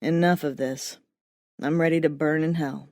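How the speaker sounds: low voice of a self-satisfied dead man; smug dead man. A narrator's reading. Lyle's dialogue.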